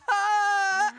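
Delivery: high laughing squeal imitating her; mock shriek. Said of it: "water splashed up on her".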